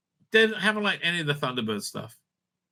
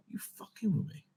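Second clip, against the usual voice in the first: hissed whisper of disbelief; incredulous whisper; 5 semitones lower, 13 dB quieter than usual. Real, not acted. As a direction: whisper it, unable to believe the price. Outraged at a price.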